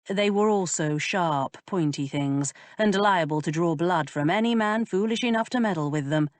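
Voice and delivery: plain reading voice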